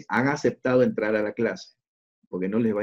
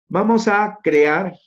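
eager announcement; 9 semitones higher, 7 dB louder, much slower than usual. Real, not acted. Announcing the next step.

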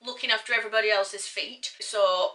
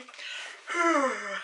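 long audible breath out; exaggerated exhale; 4 semitones higher than usual. Presenter after getting through the product name, exaggerated exhale. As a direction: let out a big mock-relieved breath. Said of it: "And exhale".

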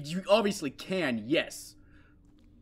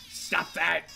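ordinary talk, nothing marked

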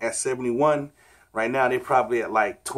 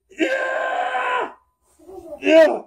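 straining shout; 4 dB louder than usual. Real, not acted. Finishing push-ups, exhausted.

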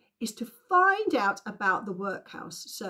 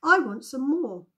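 higher-pitched repeated request; polite plea; 6 semitones higher, 5 dB louder than usual. A narrator's reading. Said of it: "in his most polite voice".

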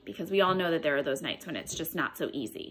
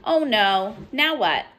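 lively storybook read-aloud with character voices; picture-book storytime voice; reading to children; 4 semitones higher than usual. Acted. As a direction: read it to a class of little ones. Teacher reads picture book aloud.

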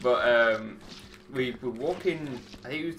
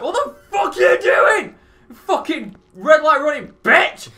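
high, full-force furious shout; enraged yelling; 14 dB louder than usual. Retold or imitated. Drunk pedestrian to red-light runner, enraged yelling. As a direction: scream it at a reckless driver. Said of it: "I just started yelling".

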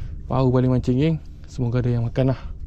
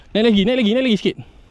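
high, raised repeats; urgent coaxing; 8 semitones higher, 4 dB louder, faster than usual. Real, not acted. Angler willing big fish to surface, urgent. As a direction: urge it up, high pitched.

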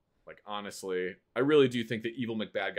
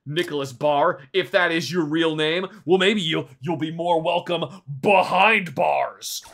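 mock stern guard; 3 semitones higher, 8 dB louder than usual. Gamer taunting border applicant.

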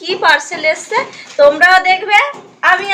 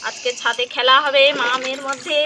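ordinary talk

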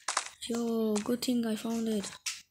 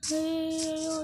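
ordinary delivery

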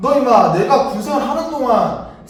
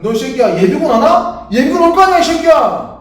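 angry yelling; 3 semitones higher, 6 dB louder than usual. Acted. Sergeant shouting threats.

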